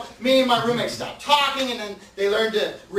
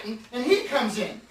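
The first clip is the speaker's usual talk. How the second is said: ordinary talk, nothing marked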